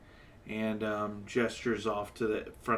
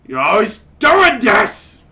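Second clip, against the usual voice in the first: loud, higher, angry outburst; furious yell; 11 semitones higher, 20 dB louder than usual. Acted. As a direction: blow up at him. Soldier slams bottle, yells.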